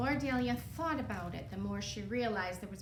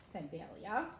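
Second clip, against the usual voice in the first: ordinary delivery, nothing marked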